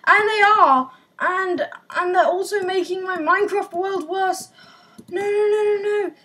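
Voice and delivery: normal talking voice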